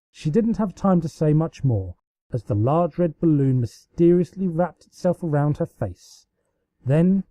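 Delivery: plain reading voice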